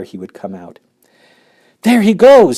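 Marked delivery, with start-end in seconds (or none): 1.8-2.6 high, loud call; a bystander shouting as the boy shoots up the pipe. Acted. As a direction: point and shout. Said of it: "somebody shouted".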